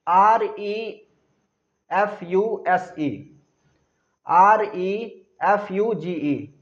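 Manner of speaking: letters spelled out slowly; slow, deliberate spelling for children. Shown as a demonstration. Teacher spells word for class.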